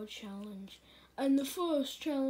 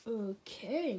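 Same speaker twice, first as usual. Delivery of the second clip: ordinary talk, nothing marked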